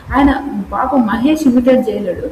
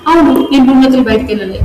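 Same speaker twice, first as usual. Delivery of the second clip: retold heated reply; 3 semitones higher, 6 dB louder, faster than usual. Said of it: "నేను ఒక మాట అంటున్నా అంటే".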